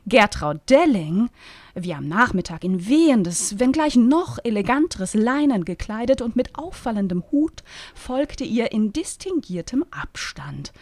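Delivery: ordinary delivery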